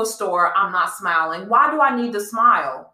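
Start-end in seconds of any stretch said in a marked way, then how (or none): none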